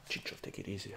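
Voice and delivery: quiet warning from friends at the pub; friends calming him. Retold or imitated. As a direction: whisper to calm a friend down. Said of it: "My friends were like".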